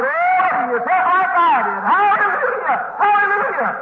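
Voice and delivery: high, ecstatic hallelujahs; revival-meeting holler; shouted praise. Retold or imitated. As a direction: cry out in high-pitched worship. Reenacting his shouted praise.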